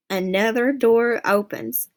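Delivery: usual speaking voice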